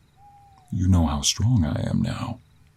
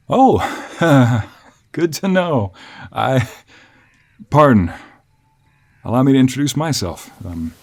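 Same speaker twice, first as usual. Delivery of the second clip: higher, eager and halting introduction; relieved polite traveler; 7 semitones higher, 6 dB louder than usual. Acted. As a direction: greet the stranger with nervous relief. Voices the traveler, relieved, introducing himself.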